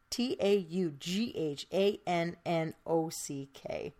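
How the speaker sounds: slow, letter by letter; spelling out. Real, not acted. Host spells the park name for listeners, slowly and evenly.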